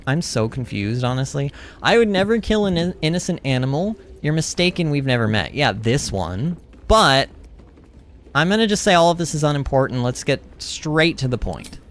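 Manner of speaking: normal talking voice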